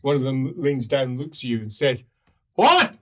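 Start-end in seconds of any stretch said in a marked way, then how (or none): 2.6-3.0 high, loud gruff guard shouting down; guard leaning down from the wall to the party, loud and high; guard calling down. Acted. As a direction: bark it down from the wall as a bored guard.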